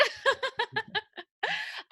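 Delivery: high, real burst of laughter; high-pitched delighted laughing. Real, not acted. Host delighted by guest's answer.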